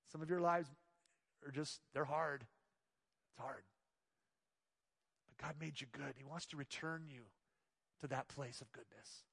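hushed, low and gentle; soft and tender. Real, not acted. Pastor gently comforting hurting listeners, hushed. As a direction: drop almost to a murmur with compassion. Pastor softens over hard lives.

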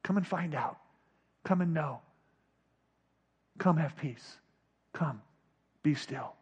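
soft, low, spaced-out invitations; hushed, unhurried coaxing; Jesus' gentle call. Acted. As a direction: beckon quietly, pausing between calls. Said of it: "Jesus is always saying".

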